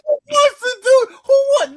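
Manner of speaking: high, loud mocking echo of her line; mocking high squeal. Teasing his co-host with a loud, high mocking echo. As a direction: squeal it back at her in disbelief. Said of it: "Do you hear how you sound?".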